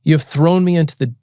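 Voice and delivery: slow, grave Bible reading; solemn scripture reading. Acted. Reading Psalm eighty-eight to a drunk stranger, slow and solemn. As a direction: read the psalm slowly and heavily.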